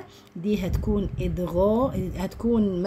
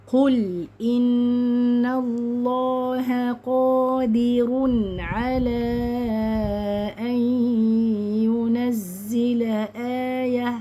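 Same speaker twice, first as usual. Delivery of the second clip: slow, drawn-out chant, raised a little; Quran recitation; 4 semitones higher, 3 dB louder, much slower than usual. Shown as a demonstration. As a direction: recite with long held vowels and nasals.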